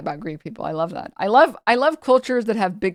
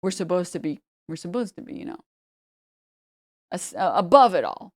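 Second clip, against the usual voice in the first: mock aloof Italian; 3 semitones lower, 4 dB quieter than usual. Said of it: "'cause it's like".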